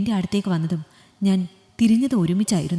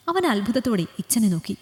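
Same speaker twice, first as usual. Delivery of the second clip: usual speaking voice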